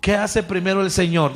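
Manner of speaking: ordinary delivery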